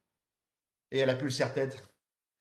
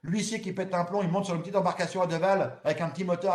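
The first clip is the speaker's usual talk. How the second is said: usual speaking voice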